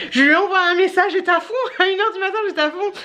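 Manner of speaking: high, excited late-night pep talk; gung-ho and laughing a little; fired up. Retold or imitated. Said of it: "j'étais au taquet".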